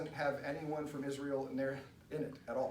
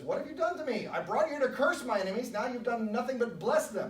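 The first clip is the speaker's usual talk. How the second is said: higher and louder, an outraged king scolding; indignant Balak; 9 semitones higher, 6 dB louder than usual. Retold or imitated. King Balak berating the prophet, outraged and loud. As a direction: throw the accusation at him, affronted.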